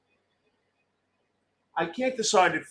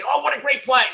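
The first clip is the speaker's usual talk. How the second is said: high, loud sarcastic cheer; mock cheer; 10 semitones higher, 4 dB louder than usual. Mocking the other team's fan with a loud fake cheer.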